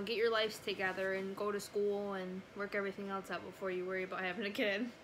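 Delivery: usual speaking voice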